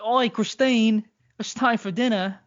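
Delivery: loud, high Cockney holler; Cockney accent. Acted. Brother teasing sister on the phone, thick Cockney. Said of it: "the old Cockney accent".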